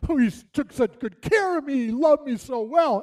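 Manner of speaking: high, loud, overwrought imitation; imitated upset widow. Pastor imitating widow praising her late husband, high and worked up. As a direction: gush it out in mock exasperation. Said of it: "she's mad".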